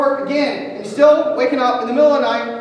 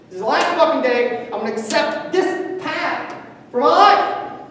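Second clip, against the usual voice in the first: rising, pushing self-challenge; fiery motivational rant; 4 semitones higher than usual. Acted. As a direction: preach it, building to a peak.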